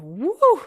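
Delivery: loud, admiring "woo"; swooning woo. Retold or imitated. Telling friends of a handsome man on the trail, swooning. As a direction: let out a smitten whoop.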